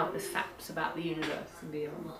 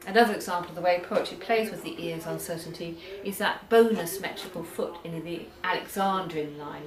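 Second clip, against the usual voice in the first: normal talking voice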